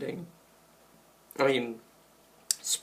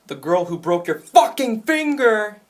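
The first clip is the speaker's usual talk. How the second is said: loud, higher-pitched angry taunt; defiant outburst; 6 semitones higher, 7 dB louder than usual. Acted. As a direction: build to a defiant yell on the swearing. Acting out Ellie's defiant taunt.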